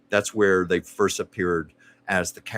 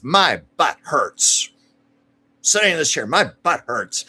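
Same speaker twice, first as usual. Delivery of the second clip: crabby mother; 5 semitones higher, 5 dB louder than usual. Retold or imitated. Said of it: "she's very crabby".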